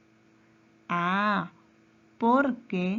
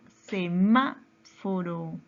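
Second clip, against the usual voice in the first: "semáforo" very slow, MA held and punched; drawn-out teacherly "semáforo", force on MA; stress on MA; 2 semitones lower, much slower than usual. Shown as a demonstration. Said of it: "En ma".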